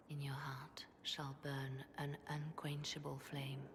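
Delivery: slow, hushed whisper. Acted. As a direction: breathe it like a sacred vow. Solemn blessing, closing words.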